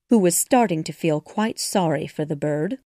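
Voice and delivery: ordinary delivery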